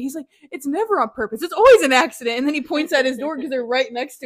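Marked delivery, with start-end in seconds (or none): 0.5-2.4 loud exasperated scolding dad; angry scolding. Retold or imitated. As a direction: shout it like a fed-up father.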